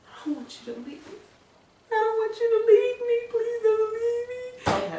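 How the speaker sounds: high, breaking, tearful begging; mock crying plea. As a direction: beg him to stay through fake sobs.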